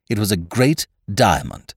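ordinary delivery, nothing marked

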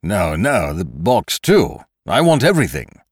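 mildly alarmed protest; a touch of panic in the demand; startled captive. A narrator's reading. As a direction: insist in a hurry to keep it all. Kesselbach gave a start.

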